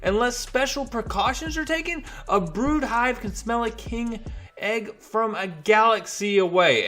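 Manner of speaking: high, odd alien voice rising to outrage; high alien scolding the New Mutants about the egg; frantic alien Brew. Acted. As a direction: squawk it like a panicked little alien.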